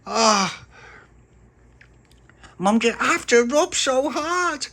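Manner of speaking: put-on high, loud, drawn-out wail of a kid being scrubbed; whining child. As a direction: whine like your ear is being pulled off. Son whines while face scrubbed.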